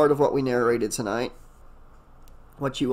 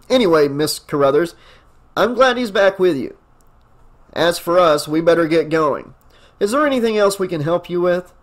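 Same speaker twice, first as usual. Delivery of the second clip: louder, brisk official police voice; deputy voice; 4 semitones higher, 6 dB louder than usual. Acted. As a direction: play the deputy, polite but businesslike. Narrator voicing deputy.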